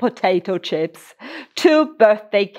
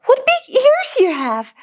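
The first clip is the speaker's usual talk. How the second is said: very high gasping surprise; gasped. Acted. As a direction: squeak it out in shock.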